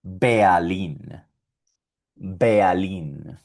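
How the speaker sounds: Berlín with a vocalized R, bealin; German Berlin. Shown as a demonstration. Teacher modeling German Berlín. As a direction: say Berlín as German bea-lin. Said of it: "y esto suena".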